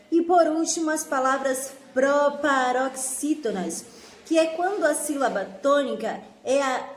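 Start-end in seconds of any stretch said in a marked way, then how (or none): none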